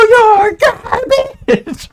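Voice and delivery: high, drawn-out screaming taunt through a megaphone. Retold or imitated. Gamer taunting a dying enemy through the megaphone, screamed. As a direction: blast it out like a megaphone troll. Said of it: "I just go".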